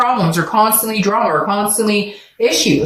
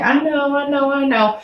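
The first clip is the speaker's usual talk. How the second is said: high, sing-song "yeah, I get it"; mock weary agreement; 7 semitones higher than usual. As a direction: mimic someone who has heard it all. Mimics viewers brushing her off.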